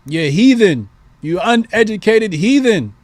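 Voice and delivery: sharp high shout on shut up, then scolding; angry retort. Pretend. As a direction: snap at them with scorn. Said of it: "tell them".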